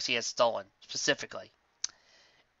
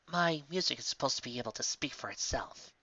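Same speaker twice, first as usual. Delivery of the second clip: softer, firm and level; quiet, stubborn reply; 9 dB quieter than usual. Acted. Lyra quietly defending her music to Trixie. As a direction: mutter it back, holding her ground.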